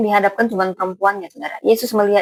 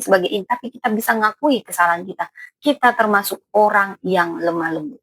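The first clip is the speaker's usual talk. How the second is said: ordinary delivery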